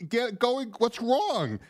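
high, slow imitation of his wife; a light take on the worried wife; imitating wife. Man imitating his wife asking what's wrong, lightly voiced. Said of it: "my wife walks in the house and is like".

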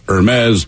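Hermes drawn out as "ur-MEZ"; slow Hermes with silent H, ur-MEZ; corrected pronunciation. Shown as a demonstration. Host repeating listeners' corrections, Hermes as slow ur-MEZ. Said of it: "It's pronounced Hermes".

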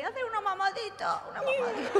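put-on high sugary baby voice; cutesy couple baby talk. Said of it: "¿Habéis visto estas parejas que hacen como".

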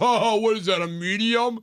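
high mocking clerk. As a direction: sneer it like a snooty clerk.

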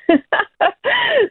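high amused laugh; burst of laughter. Real, not acted. Guest laughing at her own cheeky point to the host.

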